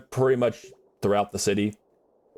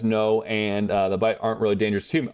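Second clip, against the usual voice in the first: ordinary delivery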